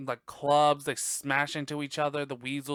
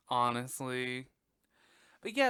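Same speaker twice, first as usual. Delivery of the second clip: ordinary delivery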